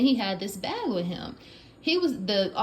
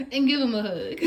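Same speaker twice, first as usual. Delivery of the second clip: laughing; 4 semitones higher, 5 dB louder, faster than usual. Real, not acted. Gushing about neighbor.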